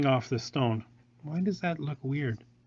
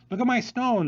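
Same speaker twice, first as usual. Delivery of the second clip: high, louder mock boast; showy pendant voice; 9 semitones higher, 4 dB louder than usual. Pendant showing off its stone to viewers, high mock boast. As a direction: voice the pendant bragging about its stone. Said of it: "it's like".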